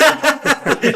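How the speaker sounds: laughing. Real, not acted. Big laugh at the joke.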